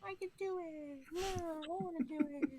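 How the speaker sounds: put-on high, whining kid voice; whiny child. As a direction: whine like a little kid.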